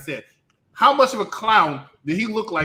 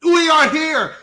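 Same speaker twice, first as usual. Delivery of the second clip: host hype; 8 semitones higher, 6 dB louder than usual. Acted. Show host announcing.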